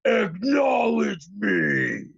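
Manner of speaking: drawn-out, very slow, crying out; hammed-up wrestler's command, dragged out; mock Roman Reigns. Mocking Roman Reigns opening SmackDown, drawn-out cry. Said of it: "and go".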